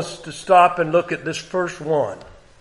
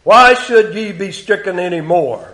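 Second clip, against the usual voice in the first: raised, deliberate King James reading; solemn scripture reading; 2 semitones higher, 5 dB louder than usual. Acted. Preacher reading God's words to congregation, raised. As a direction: read it as God's complaint against Israel.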